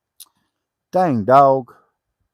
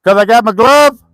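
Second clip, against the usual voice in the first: talking to dog; 8 semitones higher, 6 dB louder than usual. Real, not acted.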